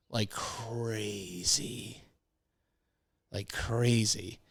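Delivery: slow, stretched-out "crazy"; drawn-out repetition. Real, not acted. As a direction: drag the words out for comic weight. Comic repeat after vomiting story.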